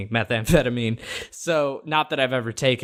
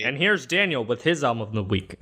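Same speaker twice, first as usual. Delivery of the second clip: mock news anchor. Teasing intro of cohost's pick.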